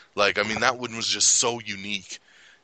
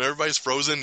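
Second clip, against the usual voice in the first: usual speaking voice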